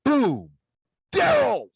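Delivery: loud bang, then high mock scream; reenacted blast and alarmed high yell; loud boom and yell. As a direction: boom it out, then shriek the name. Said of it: "All my mother heard was".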